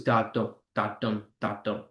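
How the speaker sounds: low, soft thudding "da-dum"; heartbeat imitation. Teacher imitating a heart for students, steady rhythmic beat. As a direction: beat it out like a pulse, fading. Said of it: "The heart goes".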